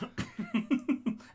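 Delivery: high burst of laughter. Real, not acted. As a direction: break up laughing. Laughing at the joke.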